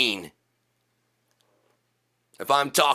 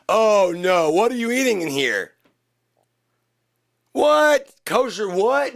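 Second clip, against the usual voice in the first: outraged disbelief; 9 semitones higher, 4 dB louder than usual. Acted. Outraged character about food.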